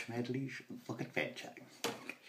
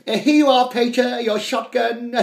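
much higher, loud schoolboy; hammed-up young son; eager posh boy; 12 semitones higher, 16 dB louder than usual. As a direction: proudly hand over the shotgun. Son hands shotgun.

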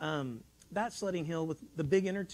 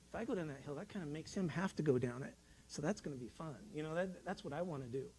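inner thought; 3 semitones lower, 8 dB quieter than usual. Retold or imitated. His inner thought retold.

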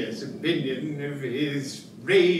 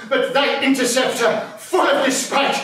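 mock alarm; 8 semitones higher, 4 dB louder than usual. Toby warning of danger.

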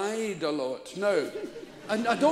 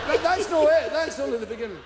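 usual speaking voice

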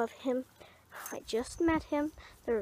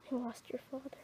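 whispered grief; 3 semitones lower, 14 dB quieter, faster than usual. Acted. Grieving mother confesses loss.

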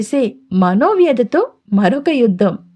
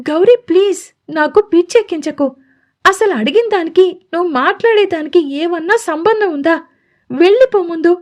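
higher, exasperated begging, mildly voiced; exasperated pleading; 6 semitones higher than usual. A narrator's reading. Radhika begging Gauri to leave, exasperated and higher. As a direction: plead with him to go, fed up. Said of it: "విసుగ్గా ... ప్రాధేయపడుతుంది".

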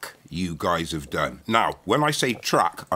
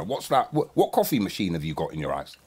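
normal talking voice

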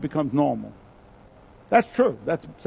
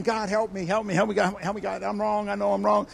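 soft, urgent, repeated plea; pleading prayer; 7 dB quieter than usual. Retold or imitated. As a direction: pray it under your voice, pleading. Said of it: "I started praying".

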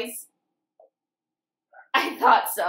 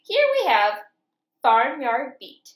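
usual speaking voice

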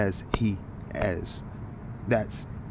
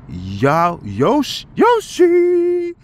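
excited build to a shout; 7 dB louder, much slower than usual. Real, not acted. Building up to the name.

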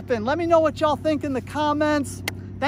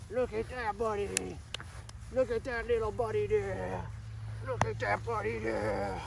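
soft cooing; 9 dB quieter than usual. Real, not acted. Trainer coaxing his distracted puppy, soft and cooing. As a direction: coax the puppy gently.

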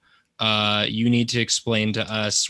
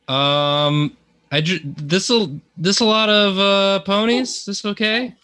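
unsure high voice; 9 semitones higher, 5 dB louder than usual. Real, not acted.

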